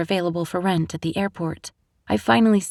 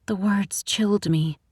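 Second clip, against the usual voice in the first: ordinary delivery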